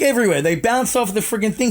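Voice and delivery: ordinary talk, nothing marked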